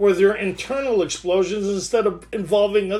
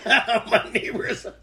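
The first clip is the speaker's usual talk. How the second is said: amused restart, higher and breaking into laughter; laughing; 5 semitones higher than usual. Real, not acted. Speaker laughs at own needless whispering, laughing. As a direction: laugh through the words.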